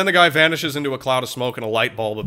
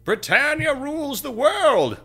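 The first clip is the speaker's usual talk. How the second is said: high, slow, grand mock-British proclamation; slow, high hammed-up British boast; pompous mock Brit; 11 semitones higher, slower than usual. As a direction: declaim it like a smug imperialist. Mocking British supremacists.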